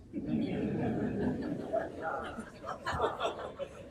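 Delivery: breathy high laugh trailing off; long high laugh. Real, not acted. Speaker laughing at his own Republican joke, long high laugh. Audience-facing laugh after punchline.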